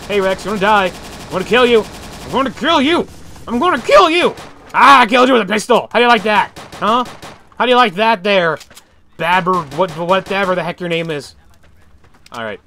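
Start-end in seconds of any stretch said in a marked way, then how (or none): 0.1-4.3 taunting shout. Real, not acted. Taunting the Rex, building. 4.7-11.3 gloating. Real, not acted. Gloating after a kill.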